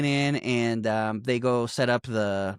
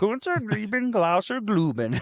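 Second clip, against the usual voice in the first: fake German; 8 semitones higher than usual. Friend quoting the song intro in mock German. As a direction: ham up a made-up German lyric. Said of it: "quote unquote German".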